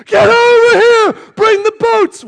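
high, full-volume holler; frantic acted scream for help. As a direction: yell across the water to a far boat. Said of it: "Peter's screaming".

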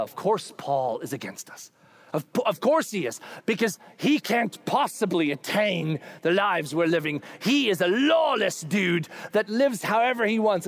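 usual speaking voice

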